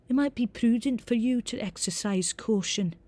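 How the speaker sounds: high, formal Scottish teacher; reedy Scottish accent. A narrator's reading. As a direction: advise carefully in a reedy Scottish voice. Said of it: "in a reedy Scottish accent".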